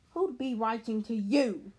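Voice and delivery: slow loud sneer. A narrator's reading. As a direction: sneer it down at him. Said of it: "sneered Uncle Vernon".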